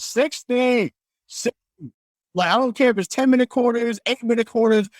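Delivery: high-pitched, fired-up rant; heated rant. Real, not acted. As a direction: go off like a proud fan. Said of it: "do I sound like a pompous American right now".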